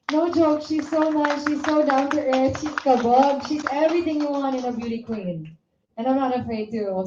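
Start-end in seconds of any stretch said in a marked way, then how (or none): 0.1-5.6 excited gushing. Real, not acted. Gushing praise of the contestant.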